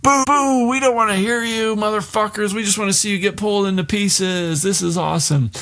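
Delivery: high, mocking crowd voice booing; jeering crowd. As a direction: heckle them like a mob at a show. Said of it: "everyone's just like".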